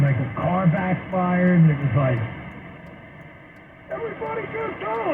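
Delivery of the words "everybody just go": high, breathy mock action hero yell; action movie drama queen. Mimicking a dramatic coworker at a backfire, mock action yell. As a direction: play the guy who thinks he's in an action movie. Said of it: "there's always a drama queen in the group".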